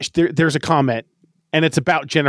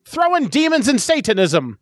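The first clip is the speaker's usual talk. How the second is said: put-on higher old-timey Transatlantic trailer voice; old-timey transatlantic accent; 5 semitones higher than usual. Pitching a Jennifer's Body tagline in an old-timey Transatlantic accent. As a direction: Transatlantic announcer teasing the audience. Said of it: "I'm gonna keep with my old-timey voice".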